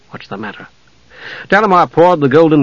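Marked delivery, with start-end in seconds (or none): 0.1-0.7 near-whispered concern; low voice. A narrator's reading. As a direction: whisper it privately. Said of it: "asked in a low voice".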